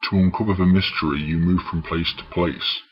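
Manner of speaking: usual speaking voice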